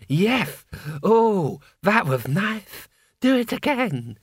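high, slow lewd gargoyle voice; savouring it, leering; leering door knocker. A narrator's reading. Knocker enjoying being pounded.

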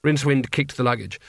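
usual speaking voice

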